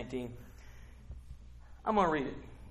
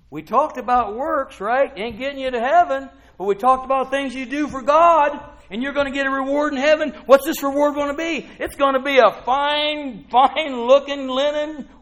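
high, loud, building promise; excited preaching; 10 semitones higher, 9 dB louder than usual. Real, not acted. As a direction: sell the reward with relish. Rousing about the heavenly reward.

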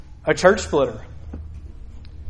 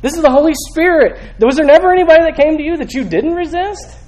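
high-pitched, forceful preaching; fired-up accusing sermon cry; impassioned rebuke; 11 semitones higher, 4 dB louder than usual. Acted. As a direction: confront them, quickening and getting louder. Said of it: "he's telling them".

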